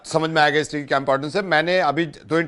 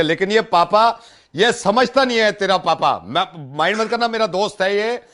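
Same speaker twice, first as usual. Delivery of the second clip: higher-pitched exasperated grumbling; mock indignation; 6 semitones higher than usual. Prank uncle grumbling about the boy's father, mock-indignant. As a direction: complain insistently, pitch raised.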